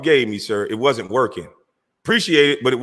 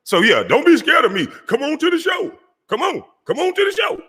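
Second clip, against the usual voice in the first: much higher, shouted mocking dare; mocking taunt. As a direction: shout the dare up high to provoke him. Taunting rival to come on.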